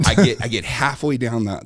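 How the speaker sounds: ordinary delivery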